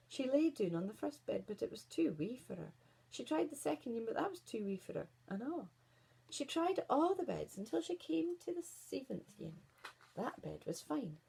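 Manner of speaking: plain reading voice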